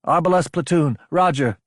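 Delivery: lightly voiced lieutenant reporting in; steady and clear. A narrator's reading. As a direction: answer the roll call clearly. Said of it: "voice was steady and clear".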